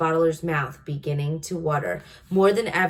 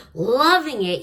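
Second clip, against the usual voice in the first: loud, higher outraged yell; yelling giant; 6 semitones higher, 6 dB louder than usual. A narrator's reading. Giant yelling back at the BFG about the vegetable, loud outraged. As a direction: throw the words back, yelling. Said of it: "yelled the blood bottler".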